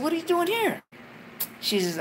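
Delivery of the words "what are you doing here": high incredulous question; baffled to find her there; startled question. Retold or imitated. To his uncle's ex at the door, high and incredulous. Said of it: "I said".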